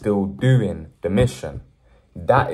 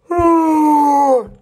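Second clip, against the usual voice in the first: loud cry; 12 dB louder than usual. Real, not acted. Sudden loud cry sound.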